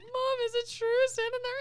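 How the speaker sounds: high, mock-tearful plea; upset child. Child asking mom whether Santa is true, high tearful mock plea. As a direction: whine it to mom like a heartbroken kid.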